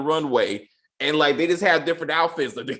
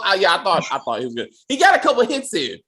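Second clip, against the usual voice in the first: ordinary delivery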